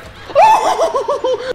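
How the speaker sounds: high startled laughing shriek. Real, not acted. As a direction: burst into a shocked shrill laugh. Laugh after jumpscare.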